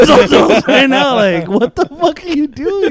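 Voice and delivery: high, loud disbelief, half laughing; incredulous scolding. Retold or imitated. Student to classmate chopping coke mid-class, incredulous.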